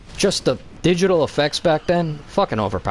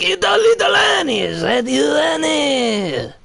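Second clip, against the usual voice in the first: Watto impression; 10 semitones higher, slower than usual. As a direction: ham up a greedy junk dealer taunting. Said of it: "and Watto's like".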